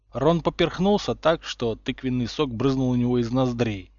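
plain reading voice

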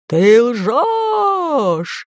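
drawn-out, booming giant's cry; seething anger. A narrator's reading. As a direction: roar it in outrage, stretching the vowel. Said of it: "закипели атланты".

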